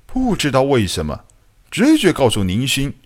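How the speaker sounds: ordinary delivery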